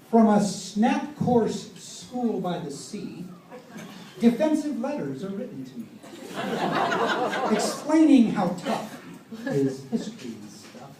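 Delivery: poem recited. Retold or imitated. Reciting son's letter in verse.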